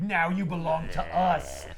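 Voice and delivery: slow, put-on villain voice; Venom freaking out. Venom claims Peter's kidnapped parents, freaking out. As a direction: drag it out as a menacing captor. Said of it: "freaking out at the parents".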